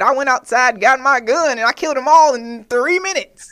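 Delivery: loud retelling of his confession. Retold or imitated. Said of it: "he said".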